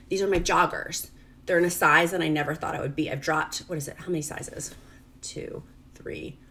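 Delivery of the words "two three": whispered count to herself; counting under breath. Real, not acted. Mom counting her dropped pant sizes in a whisper. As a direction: murmur the numbers as if tallying.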